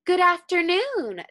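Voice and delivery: a small chicken politely greeting the guards; Half Chicken greeting. A narrator's reading. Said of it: "said Half Chicken".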